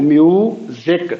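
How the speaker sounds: Music slowly split as mu, then ज़िक; syllable by syllable. Shown as a demonstration. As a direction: break music into two drawn-out syllables. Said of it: "syllables कितने हैं".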